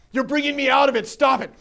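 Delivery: loud, high, irritated protest; high, loud retort from the annoyed client; annoyed outburst. Retold or imitated. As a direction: snap back at being interrupted. Annoyed client snapping back.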